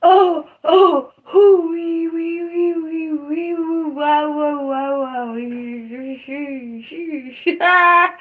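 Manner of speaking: high "oh, oh" into a wavering "wee, woo, shoo" wail, fading then bursting into "ah"; pained wailing. Real, not acted. Streamer riding out pain on a live, wailing nonsense sounds. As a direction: vent pain in strung-out nonsense syllables. Wordless venting through the pain.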